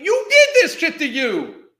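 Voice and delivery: loud, high, with a laugh; laughing, scornful yell at the woman on screen; yelled accusation. Real, not acted. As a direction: shout the blame at her. Yelling at woman in video.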